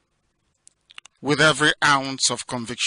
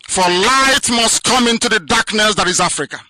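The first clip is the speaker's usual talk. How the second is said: higher and louder, preaching at full force; fiery oratory; 9 semitones higher, 7 dB louder than usual. Acted. Leader rallying his listeners on the radio, fiery oratory. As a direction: rouse the crowd like a pulpit orator. Broadcast speech to Biafrans.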